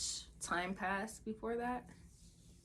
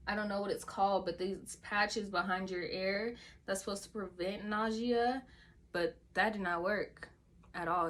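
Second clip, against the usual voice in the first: ordinary talk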